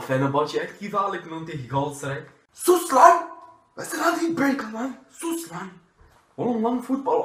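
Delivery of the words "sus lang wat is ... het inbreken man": loud hushing shout. Acted. Partner hushes loud football talk.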